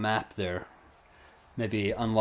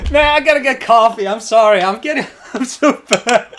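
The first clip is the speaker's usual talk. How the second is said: loud, high, laughing through the words; 13 semitones higher, 12 dB louder than usual. Real, not acted. Streamer laughs loudly at his own mistake. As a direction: crack up at yourself.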